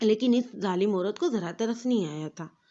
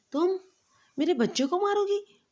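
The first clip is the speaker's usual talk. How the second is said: furious roar, much higher; 9 semitones higher than usual. A narrator's reading. Husband rages at the wife over his child, furious. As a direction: rage at her for the child. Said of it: "انتہائی غصے سے گرایا".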